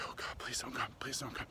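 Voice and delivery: desperate hushed self-talk; whispered pleading. Retold or imitated. Man pleading with himself during sex, whispered. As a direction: beg under your breath, barely audible. Dreading climax during Judge Judy.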